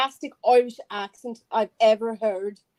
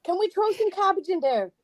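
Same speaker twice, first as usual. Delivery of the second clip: higher, laughing mock Irish; Irish accent; 6 semitones higher, 6 dB louder than usual. Asking for cabbage in a laughing higher mock Irish accent. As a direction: ask through giggles in a put-on Irish lilt. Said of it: "So, you know this accent".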